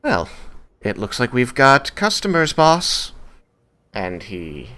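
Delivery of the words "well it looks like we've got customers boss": higher, lilting and amused; light, amused. Acted. Wood elf calling to his boss about customers, amused. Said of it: "in a light and amused voice".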